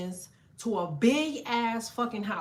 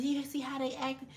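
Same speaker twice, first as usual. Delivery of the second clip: a hushed, higher, sulky mimic of the coworkers, softer; mocking coworkers; 4 semitones higher, 7 dB quieter than usual. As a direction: mimic the grumbling staff under your breath. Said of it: "They just like".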